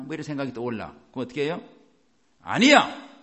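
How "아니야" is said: loud, high cry of refusal; defiant refusal. Acted. Preacher showing how to answer despair, defiant shout. Defying the discouraged thought.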